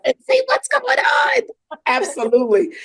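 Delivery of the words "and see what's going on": much higher, loud playful exclaim; excited echo. Real, not acted. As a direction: shout it back with delight. Excitedly echoing the host.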